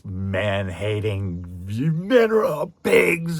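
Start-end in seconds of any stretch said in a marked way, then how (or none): 1.7-3.4 high, loud, slow mock witch scorn; Circe's scorn. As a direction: sneer it at all men. Circe's man-hating speech.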